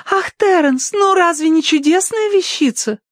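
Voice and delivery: sky-high admiring coo; Tossie. A narrator's reading. Tossie to Terence about the wondrous piece, enraptured. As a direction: gush adoringly in a squeaky high voice. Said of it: "восхищённо сжимая руки".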